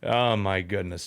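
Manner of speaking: ordinary delivery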